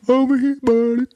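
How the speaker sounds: put-on very high baby-talk call; puppy-greeting voice. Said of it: "trying to do it to just people".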